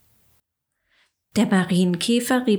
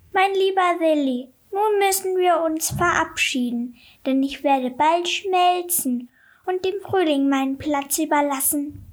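much higher snow woman voice, gentle farewell; a tender goodbye as the snowwoman; chattering melting snowman voice; 10 semitones higher than usual. A narrator's reading. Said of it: "sagte sie also eines Tages zu Willi".

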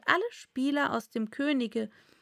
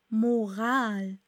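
ordinary delivery

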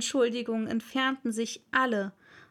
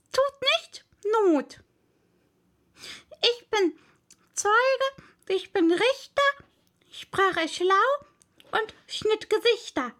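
high, emphatic mouse voice; high-pitched mouse chanting out a verse; reciting mouse; 9 semitones higher, 4 dB louder than usual. Acted.

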